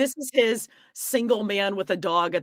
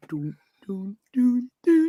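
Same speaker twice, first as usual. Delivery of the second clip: sung-out "doo doo" noises; mock sauntering hum; 4 semitones higher than usual. Imitating Robin strolling in, mock "doo doo" tune. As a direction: toot a casual little walking tune. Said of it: "Robin's coming in like".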